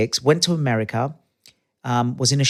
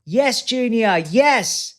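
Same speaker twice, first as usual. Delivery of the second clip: forced cheer; 10 semitones higher, much slower than usual. Retold or imitated.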